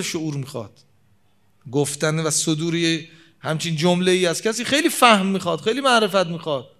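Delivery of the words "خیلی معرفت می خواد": ordinary talk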